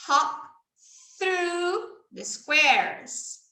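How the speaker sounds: You lost your turn said very slowly; slow teacher demo. Shown as a demonstration. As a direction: spell it out slowly for a child. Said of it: "demo for a child".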